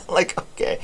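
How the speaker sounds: breathy laugh then an amused okay; real laugh at a funny line. Real, not acted. Laughing at a chat one-liner.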